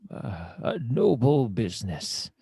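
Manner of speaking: slow, rolling in-character approval; theatrical adventurer. Acted. Kane compliments the hunters' trade, slow and theatrical. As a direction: pronounce it with slow pomp.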